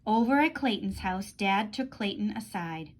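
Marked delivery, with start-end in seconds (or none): none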